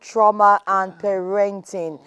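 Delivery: radio host welcome back. Acted. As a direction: announce the show's return like a radio host. Host returns from the break.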